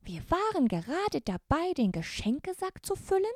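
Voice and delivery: very high, squeaky elf voice; Christmas elf. Acted. The elf Sternenlicht greets the brave girls, very high elfin voice. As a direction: greet them as a tiny, courtly elf.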